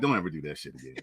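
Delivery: low, quiet, scolding big brother; imitating a stern brother. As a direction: mutter it like a fed-up older brother. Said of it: "be like".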